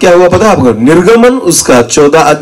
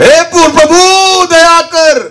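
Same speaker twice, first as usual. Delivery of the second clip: desperate cry; slower than usual. Retold or imitated. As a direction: wail to the Lord in trouble. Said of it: "बहुत जोर से चिल्लाते हैं लोग".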